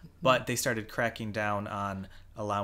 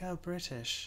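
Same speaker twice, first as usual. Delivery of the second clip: quick mock British accent, softer; 7 semitones higher, 7 dB quieter, slower than usual. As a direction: toff British voice, lightly done. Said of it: "now that I just did a British accent".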